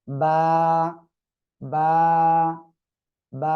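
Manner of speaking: বা with both lips, vowel held one count; teacher's measured, slightly drawn-out বা; lengthened vowel. Shown as a demonstration. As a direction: model বা firmly, drawing out the vowel a little. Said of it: "এক আলিফ টেনে পড়তে হবে".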